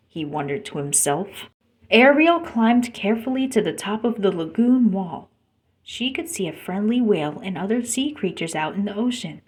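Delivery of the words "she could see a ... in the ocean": plain reading voice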